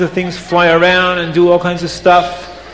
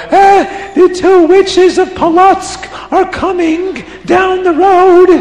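high, loud alarmed cry; alarmed guest; 13 semitones higher, 4 dB louder than usual. Acted. Guest at the window, crying out high in alarm. Guest spots witches coming.

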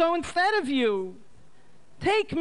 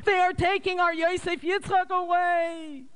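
very high mourning mother's voice; mother cries to her late husband, high and lamenting; bitter lament. A narrator's reading. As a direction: wail over her son's arrest. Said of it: "And she cried bitterly".

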